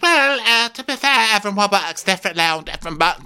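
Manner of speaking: squeaky defensive underling; whiny excuse voice. Retold or imitated. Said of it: "It's like".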